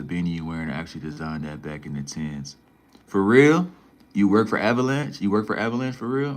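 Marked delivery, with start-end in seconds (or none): 3.1-6.4 high and loud, caught off guard; genuinely shocked questions; surprised. Real, not acted. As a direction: react in real disbelief. Shocked at chat's claim.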